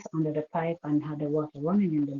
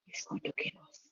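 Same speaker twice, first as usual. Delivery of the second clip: frightened whisper; 15 dB quieter, faster than usual. Retold or imitated. Terrified child whispering a threat.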